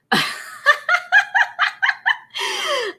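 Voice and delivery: a long, high cackle. Real, not acted. Host reading a crude viewer comment, high cackle.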